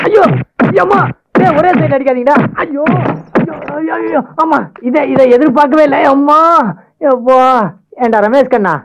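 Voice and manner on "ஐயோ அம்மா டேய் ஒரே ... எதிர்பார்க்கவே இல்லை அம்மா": heavy hit cry. Acted. Friend being beaten, crying out.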